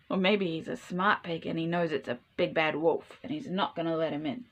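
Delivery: plain reading voice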